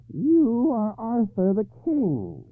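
high, slow harsh croak of a hag. A narrator's reading. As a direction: croak it out like a grotesque old woman. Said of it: "in a harsh, croaking voice".

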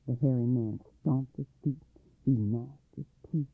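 ordinary delivery, nothing marked

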